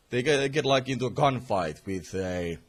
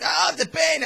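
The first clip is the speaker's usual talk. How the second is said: higher, louder mock groan of pain; 6 dB louder than usual. The Joker taking his helmet off, mock pained cry. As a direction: ham up the pain. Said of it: "and is like".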